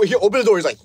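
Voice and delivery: ordinary talk, nothing marked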